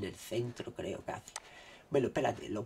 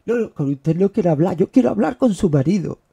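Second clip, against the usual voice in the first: much louder hammed-up caller; imitating caller; 7 semitones higher, 18 dB louder than usual. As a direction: bark the caller's demand, hammed up. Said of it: "me ha pasado más veces".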